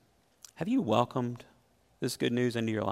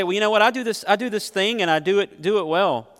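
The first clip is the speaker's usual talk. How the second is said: higher, louder self-satisfied excuse; smug excuse; 7 semitones higher, 5 dB louder than usual. Retold or imitated. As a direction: mimic a complacent churchgoer. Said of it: "it's easy to say".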